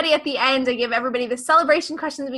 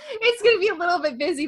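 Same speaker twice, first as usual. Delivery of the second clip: laughing; 6 semitones higher, faster than usual. Real, not acted. Laughing over busy September.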